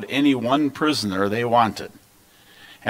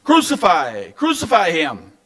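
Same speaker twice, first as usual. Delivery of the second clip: repeated high-pitched crowd cries; raised, controlled narrated shout; insistent shouting; 6 semitones higher, 3 dB louder than usual. A narrator's reading. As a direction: keep shouting it like a relentless crowd. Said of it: "But they kept shouting".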